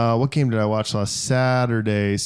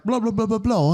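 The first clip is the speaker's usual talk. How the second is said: high-pitched mock British chatter; British accent; 10 semitones higher than usual. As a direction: babble in an imitated British accent. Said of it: "like, this British accent".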